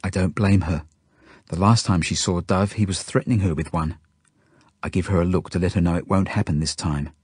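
usual speaking voice

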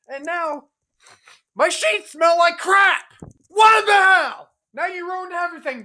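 high, loud, outraged yell at the doll; furious shout. Acted. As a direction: scream at him as if he ruined everything.

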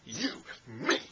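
pointing, preachy Denzel rant, lightly voiced; Denzel Washington impression. Mocking Denzel in John Q scolding about a guitar, lightly voiced. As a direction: jab each word like pointing at things. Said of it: "That's basically Denzel Washington".

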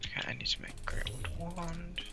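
whispering to self. Real, not acted. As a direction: murmur it under breath. Whispered aside while crafting.

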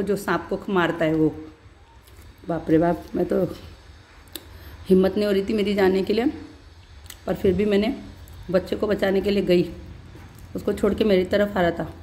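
ordinary talk, nothing marked

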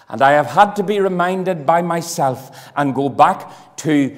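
booming revivalist preacher; preaching at full force. Acted. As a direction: drive it home from the pulpit. Fervent preaching of his prayer.

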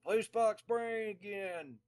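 loud, high fan request; shouting fan. Retold or imitated. Fan calling to the band from near the stage, loud. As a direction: call it out high from the crowd. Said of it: "some guy towards the front of the stage".

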